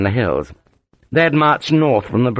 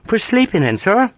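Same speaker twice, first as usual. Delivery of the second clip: higher Ulster sergeant, straight-faced; deadpan sergeant; 11 semitones higher than usual. A narrator's reading. Sergeant teasing his officer, mild deadpan Ulster voice. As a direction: keep a dead straight face. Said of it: "kept a rigidly straight face".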